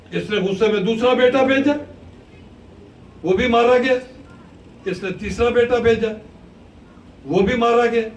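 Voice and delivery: preaching at full force. Acted. Preacher narrates Abbas's anguish, loud and driving. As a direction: drive the battle story home to the crowd.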